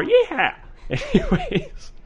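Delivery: high laughing "yeah". Real, not acted. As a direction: crack up at your own joke. Laughs at own Texan voice.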